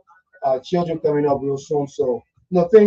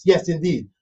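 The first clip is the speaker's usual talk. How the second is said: ordinary talk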